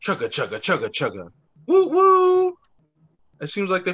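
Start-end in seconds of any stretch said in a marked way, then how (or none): 0.0-1.3 low, rhythmic chugga of a train; train chugging. Acted. Reader sounding out the toy train set off by the kids, low chugging. Train noise in kids' story. 1.6-2.6 high, loud train whistle woo. Acted. Reader voicing the finished toy train's whistle, loud and high.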